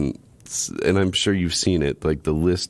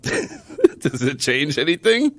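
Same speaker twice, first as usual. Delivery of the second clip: high, slow, through laughter; asking while laughing; 9 semitones higher, slower than usual. Real, not acted. Defensive laughing question.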